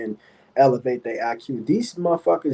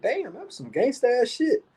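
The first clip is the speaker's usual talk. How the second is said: higher, impressed retold exclamation; amazed; 10 semitones higher than usual. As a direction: show how blown away you were. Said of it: "I was like".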